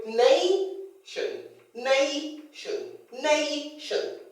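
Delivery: NA-tion, first syllable long, high and forceful; stress on first syllable. Shown as a demonstration. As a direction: stretch the NAY in nation, drop the tion. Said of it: "extra force".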